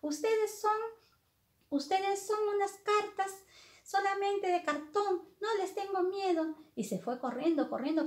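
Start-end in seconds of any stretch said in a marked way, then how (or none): 0.0-6.6 put-on high, insistent girl's voice; storybook heroine defending herself; defiant Alicia. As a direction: stand your ground in a much higher voice. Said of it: "Se defendió y le dijo".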